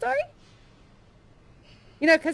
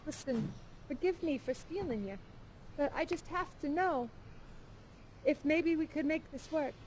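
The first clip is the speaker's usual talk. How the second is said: a much softer, shy plea from the kidnapper; soft pleading; 12 dB quieter than usual. Acted. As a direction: ask her gently, almost ashamed. Kidnapper asks Mabel for love.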